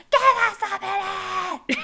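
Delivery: high, stretched screechy yell; mock shriek, dragged out high and loud; imitated freak-out. Girls yelling through the bathroom door, freaking out. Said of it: "freaking out, you know?".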